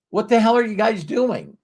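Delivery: mock-baffled question, pitched high; high-pitched, lightly acted bafflement; baffled latecomer. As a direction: imitate a clueless latecomer butting in high. Latecomer interrupts the meeting, puzzled.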